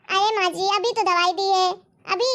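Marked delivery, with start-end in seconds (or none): none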